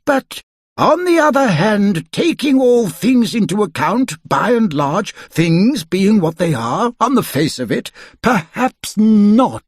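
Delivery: put-on a fussy old wizard delivering judgement. A narrator's reading. Wizard voicing judgement on Mister Stingy.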